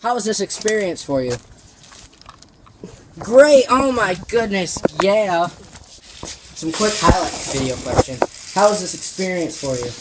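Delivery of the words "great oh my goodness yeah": high, loud, bright shout; excited. Pretend. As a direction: burst out with joy. Mock-excited interview answer.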